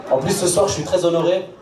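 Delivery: ordinary delivery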